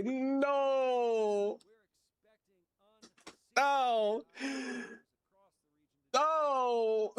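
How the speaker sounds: high, hammed-up cry of no; mock despair. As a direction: wail no like a betrayed hero. Mock despair over in-game loss.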